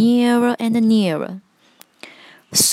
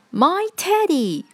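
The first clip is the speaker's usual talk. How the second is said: excited cry; 9 semitones higher than usual. A narrator's reading. Eddie sees his lost teddy, excited cry. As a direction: cry it out with pitch leaping up. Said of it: "cried Eddie".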